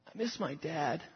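barely audible, hushed and subdued; quietly. Retold or imitated. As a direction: say it almost under your breath, sadly. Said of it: "very sort of quietly".

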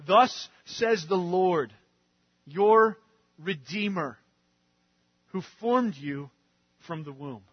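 slow, weighty reading of the verse; slow scripture reading. Acted. Preacher reading Isaiah to the congregation, slow and weighty. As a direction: read God's words slowly to the congregation.

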